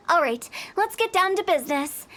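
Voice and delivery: normal talking voice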